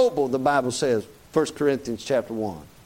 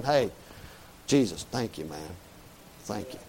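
hushed thanks; 7 semitones lower, 9 dB quieter than usual. Pretend. Imagined thanks from Barabbas to Jesus.

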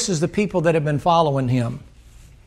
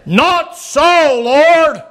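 Peter's protest again; 7 semitones higher, 5 dB louder, much slower than usual. Acted.

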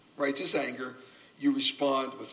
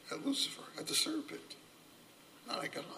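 dropped to a near whisper; hushed repeat; 11 semitones higher, 14 dB quieter, faster than usual. Acted. Preacher softly repeating the point to the congregation. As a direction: let it fall away to a whisper.

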